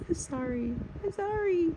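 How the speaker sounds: high, soft apology after a laugh; apologetic giggle. Real, not acted. Vlogger apologising to viewers after laughing, giggly apology.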